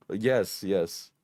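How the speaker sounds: ordinary talk, nothing marked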